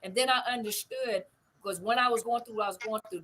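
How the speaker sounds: normal talking voice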